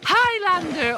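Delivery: high excited shout of the answer. Real, not acted. Gamer guessing a movie quiz, excited shout. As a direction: blurt the answer out thrilled.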